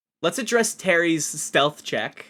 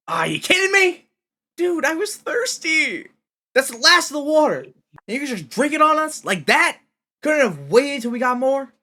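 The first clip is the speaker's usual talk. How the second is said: loud, high furious complaint; angry Shane; 9 semitones higher, 5 dB louder than usual. Acted. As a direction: rage at him over the water. Shane angrily scolds Glenn.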